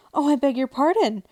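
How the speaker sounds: high, loud hurried apology; loud, high, hasty "I beg your pardon"; hasty cry. Acted. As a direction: blurt the apology out fast. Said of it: "cried Alice hastily".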